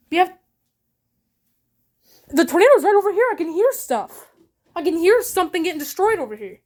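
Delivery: alarmed, hearing things break outside; frightened. Real, not acted. Hears destruction during tornado.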